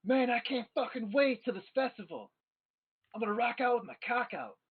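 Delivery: high-pitched rowdy festival-goer yell; acted yell, pitched well up; excited yelling. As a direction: holler it like a pumped-up party guy. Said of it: "he starts yelling".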